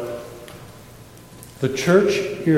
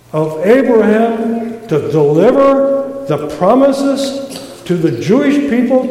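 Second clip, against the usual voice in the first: usual speaking voice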